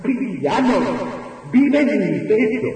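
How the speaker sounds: high, anguished outcry; crying out aloud. Acted. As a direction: hurl it out in grief and defiance. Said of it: "Quiso decir ... a voces".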